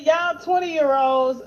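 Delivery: loud, slow, drawn-out taunt; mock-loud scolding of the young; retold loud jab. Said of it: "I said".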